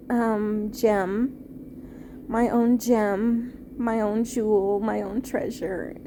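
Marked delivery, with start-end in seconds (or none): none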